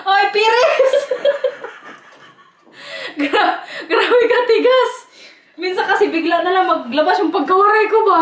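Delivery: high, squealing through real laughter; helpless laughter. Real, not acted. Vlogger joking about her Waray accent, in fits of laughter. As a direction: laugh so hard the words barely get out.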